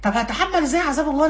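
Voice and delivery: ordinary talk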